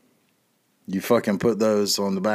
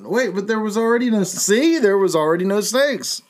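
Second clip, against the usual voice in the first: mock exasperation; 9 semitones higher than usual. Acted doubter in mock argument.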